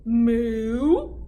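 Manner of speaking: loud lowing moo; mock moo. Cow answering the witch with a loud moo.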